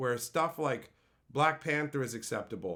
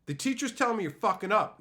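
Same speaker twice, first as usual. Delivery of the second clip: raised-pitch scolding parent; mock parental yell; 6 semitones higher, 4 dB louder than usual. Parent backing teachers scolds the kid, mock yelling. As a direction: give a mild mock yell at your kid. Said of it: "parents would yell with the teachers".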